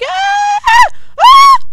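very high, loud "ah" shrieks; piercing high demo cries. Shown as a demonstration. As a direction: demonstrate a high yell the game can hear. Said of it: "only can see my higher voice".

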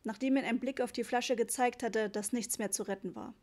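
ordinary delivery, nothing marked

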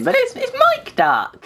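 very high, slow squeaky "Mike Duck"; high cartoon squeak. Announcing duck.

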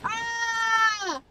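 short high scream of fright; startled scream. Real, not acted. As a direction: scream at the jumpscare. Startled by a jumpscare.

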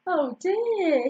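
ordinary delivery

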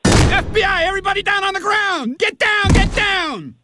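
high urgent barked orders; forceful commands. Acted. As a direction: shout the arrest orders. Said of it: "Here's Ronnie's entire first scene".

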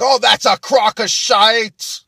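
put-on drawn-out, high Irish-accented yell; Irish guys. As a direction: heckle loudly in a mock Irish accent. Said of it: "some Irish guys are like".

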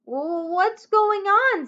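put-on high, slow, puzzled boy's voice; puzzled Jack. A narrator's reading. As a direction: voice young Jack, wondering aloud.

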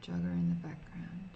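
low breathy whisper. Shown as a demonstration. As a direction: whisper it to the screen. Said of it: "wait until a jogger appears ... and say".

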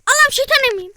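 loud, high cry to God; panicked shout. Real, not acted. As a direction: blurt it out in a panic. Oyunda panikle bağırış. (panik içinde bağırış)

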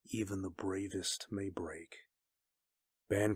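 soft, understated somber warning. A narrator's reading. Stark warning Kyra that even the bravest break, somber. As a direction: say it softly, with grave weight. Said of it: "Stark said somberly".

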